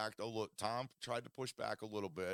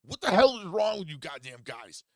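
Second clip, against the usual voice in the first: high, loud mock yell at his friends; a hammed-up scream of rage; retold screaming; 8 semitones higher, 8 dB louder than usual. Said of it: "screaming at my friends".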